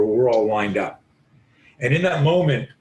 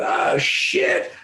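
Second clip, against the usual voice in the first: shrieking "Ah, shit" far higher than his own voice; a cartoonish squeal of fright; mock panicked yelp. Taekwondo guy sees the truck.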